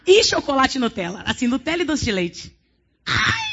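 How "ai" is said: sudden very high comic squeal; a preacher mock-crying out as if being taken away; mock shriek. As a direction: yelp as if grabbed. Comic outcry mid-sermon.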